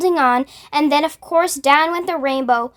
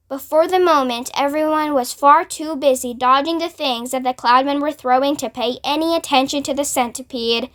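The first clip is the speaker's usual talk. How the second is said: plain reading voice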